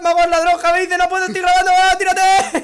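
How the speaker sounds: high, loud yelling at a player; frantic shout; loud screaming. Real, not acted.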